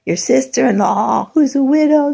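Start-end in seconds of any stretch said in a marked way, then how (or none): none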